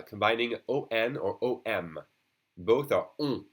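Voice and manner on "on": "on" as a nasal French rounded vowel, no N. Shown as a demonstration. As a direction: push "on" through the nose. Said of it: "the third very nasal sound".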